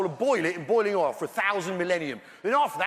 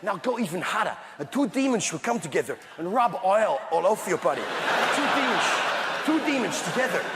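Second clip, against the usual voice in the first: put-on comic Dutch accent, shushy esses; leering, hammed-up Dutch accent. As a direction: sell hell in a Dutch accent. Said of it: "Hell in a Dutch accent".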